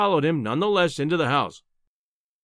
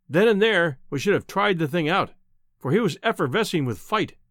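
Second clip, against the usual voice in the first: ordinary delivery, nothing marked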